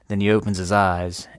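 normal talking voice

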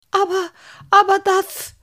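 high, loud, panicky stutter; squeaky scared character voice; frightened stammer. A narrator's reading. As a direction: force it out, trembling with fright. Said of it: "brachte Halbschuh schließlich hervor".

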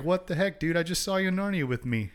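high-pitched indignant imitation; mock outrage. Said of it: "she's super mad at him".

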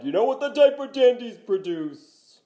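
high, loud excitable sportscaster; hammed-up, shouted announcer impression; Dick Vitale. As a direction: keep hollering like a hyped-up college hoops announcer. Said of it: "Dick Vitale impression".

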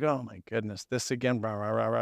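low, muttered mock grumble; grumbling crowd. Cheated crowd watching Jesus favor Zacchaeus, grumbling low. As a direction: grumble under your breath like an irritated crowd. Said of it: "You just hear the grumble through the crowd".

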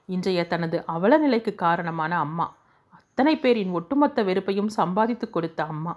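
ordinary delivery, nothing marked

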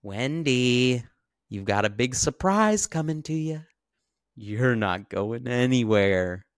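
loud, sinister mock threat; menacing Jack. Trapped husband threatening his wife Wendy, loud menacing mock voice. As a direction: taunt her like a madman. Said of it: "And then he says".

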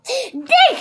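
high, loud command; Captain Bone shouting. A narrator's reading. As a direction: shout a one-word order. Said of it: "shouted Captain Bone".

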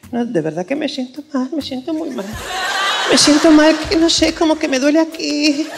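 mock Spanish accent. Spanish girl complaining from behind the clinic curtain in a mock Spanish accent. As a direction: overplay a sick Spanish girl from Spain. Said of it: "tu imitación de español".

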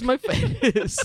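high, mock little-kid whine; a hint of a toddler's whimper; child's sleepy complaint. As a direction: mimic a drowsy child complaining. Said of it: "he goes, he goes".

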